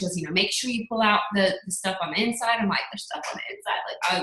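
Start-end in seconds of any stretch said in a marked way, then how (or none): 2.9-3.8 voiceless, high, stunned whisper; shocked whisper. Retold or imitated. Learning turkeys have giblets, whispering in shock. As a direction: breathe it out in disbelief.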